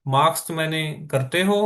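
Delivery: usual speaking voice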